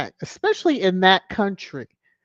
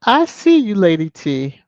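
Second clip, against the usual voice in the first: playful tease; 2 semitones higher, 7 dB louder than usual. Real, not acted. Teasing a viewer.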